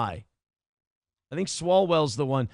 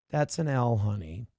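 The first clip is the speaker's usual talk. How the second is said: ordinary talk, nothing marked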